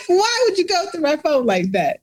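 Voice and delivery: high mock whine broken by laughter; laughing mock whine. Retelling the boyfriend's complaint to her friend, laughing through a high mock whine. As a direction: giggle through his indignant question.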